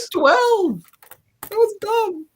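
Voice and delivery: put-on very high little boy voice; kid voice. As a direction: jump way up into a child's voice. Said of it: "He can put on a young kid's voice".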